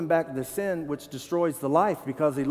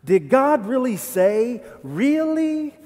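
slow, higher mocking really; the serpent taunting Eve, sarcastic; 7 semitones higher, 4 dB louder, slower than usual. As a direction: sneer out the question. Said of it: "he asked the woman sarcastically".

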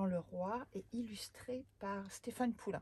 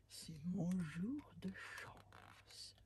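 sly whisper; 6 dB quieter, much slower than usual. Acted. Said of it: "fit le géant".

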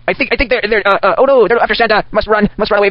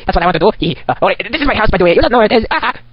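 usual speaking voice

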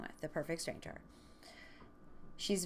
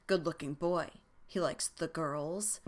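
bad Boris and Natasha accent. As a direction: play her with a bad cartoon Russian accent. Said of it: "like, Boris and Natasha accent".

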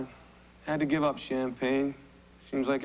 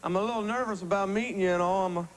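put-on cornball country accent. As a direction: play the nervous hayseed. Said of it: "this cornball accent".

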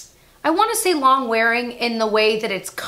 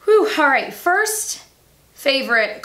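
big relieved exhale; out of breath; 4 semitones higher, 3 dB louder, slower than usual. Real, not acted. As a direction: catch your breath first. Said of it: "slow yourself down".

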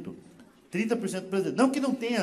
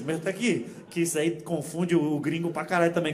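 usual speaking voice

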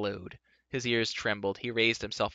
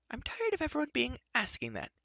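put-on higher, peevish girl; Elodie's voice; 3 dB quieter than usual. A narrator's reading. Girl annoyed at being asked, higher and peevish. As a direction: complain like a fed-up girl.

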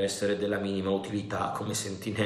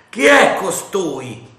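loud, high, slow challenge; shouted; 9 semitones higher, 12 dB louder, slower than usual. A narrator's reading. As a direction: call out who goes there. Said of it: "gridò il capitano".

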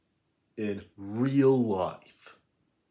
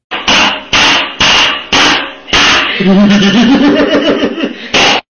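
airy, much louder and higher mocking laugh; loud breathless cackle; 10 semitones higher, 17 dB louder than usual. Kid cackling at his own roast. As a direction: cackle wildly at your own joke.

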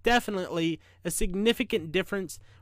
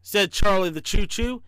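ordinary delivery

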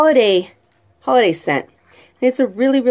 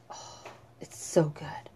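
breathy whisper of delight; whispered praise, then said aloud; 5 semitones lower, 17 dB quieter than usual. Acted. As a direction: whisper it like a secret treat.

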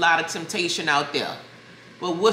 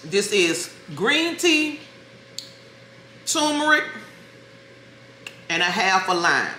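slow and clear; 3 semitones higher than usual. Real, not acted. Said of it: "Stop talking with my mouth full".

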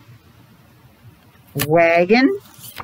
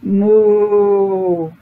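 cow moo. Shown as a demonstration. Animal sounds while reading aloud.

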